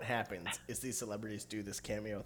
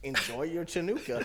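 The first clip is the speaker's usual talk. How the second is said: higher-pitched mock reading of a greeting; lightly voiced oblivious star reading aloud; mock clueless celebrity; 7 semitones higher, 5 dB louder than usual. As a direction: imitate someone breezily reading an unknown word. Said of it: "he's just going, going and reading".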